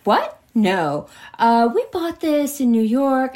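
mock stunned wife; startled disbelief. Woman in disbelief.

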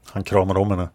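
ordinary delivery

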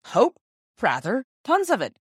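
a father cheerfully reassures his son, high and loud. A narrator's reading. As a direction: toss the worry aside with a hearty lift. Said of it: "said Father cheerfully".

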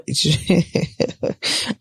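breathy, helpless chuckle; worn-out giggle; tired laugh. Real, not acted. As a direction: laugh through the exhaustion. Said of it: "I've gotta laugh".

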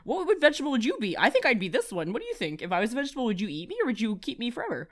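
high, fast, giddy rambling at the friend; hyper rambling. Retold or imitated. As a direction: babble at her like a kid off her meds. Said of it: "and I'm like".

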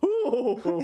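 high, laughing mock ooh; mock weird ooh. Friend mimics Jimmy Savile's creepy noise, high and laughing. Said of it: "So he just goes".

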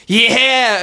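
imitated heckler holler. Audience guy yelling out mid-show, mock holler. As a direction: holler like a rowdy crowd member.

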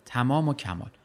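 ordinary delivery, nothing marked

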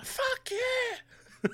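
high stretched scream; screaming at a driver. Retold or imitated. Comedian screaming at an old woman driver, high scream. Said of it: "I just started screaming".

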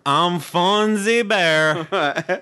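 high, slow goofy bear voice; Fonzie Bear voice. Acted. Cohost asks host for Fonzie Bear voice. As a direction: drag it out like a dopey cartoon bear. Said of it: "Can you give me a, a Fonzie Bear?".

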